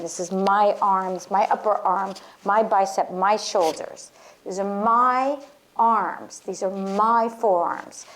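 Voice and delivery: each body part claimed, "my" stressed; spoken affirmation leaning on "my". Shown as a demonstration. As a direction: press down on "my" as you name each part. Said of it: "feel yourself holding your body and saying".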